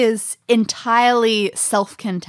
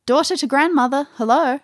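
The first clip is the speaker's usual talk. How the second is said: lightly voiced girl, higher and quick; girl's voice; 7 semitones higher than usual. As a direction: pitch it up slightly for a recorded girl. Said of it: "and a girl's voice".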